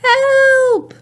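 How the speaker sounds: high, drawn-out cry for help; dog crying help. Acted. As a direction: call out a long, high-pitched help. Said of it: "the dog is saying".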